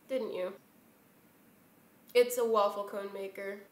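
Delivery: ordinary delivery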